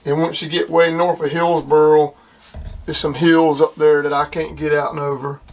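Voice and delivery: normal talking voice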